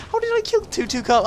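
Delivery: jumps high in astonishment; squeaky disbelief. Real, not acted. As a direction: shriek it in disbelief.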